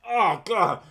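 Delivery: high, loud pained oh God; exasperated groan. Retold or imitated. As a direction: whine it like it hurts. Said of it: "and I'm like".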